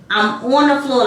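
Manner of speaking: ordinary delivery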